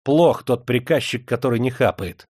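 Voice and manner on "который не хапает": plain reading voice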